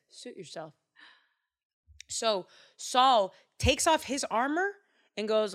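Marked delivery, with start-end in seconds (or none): 0.1-0.7 quiet shrug. Acted. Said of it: "Saul basically says".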